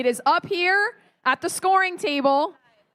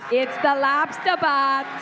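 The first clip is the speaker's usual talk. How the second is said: fake Boston accent; 3 semitones lower, slower than usual. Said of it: "my terrible fake Boston accent".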